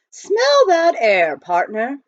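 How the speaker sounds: savouring the air. A narrator's reading. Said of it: "Praiseworthy would say".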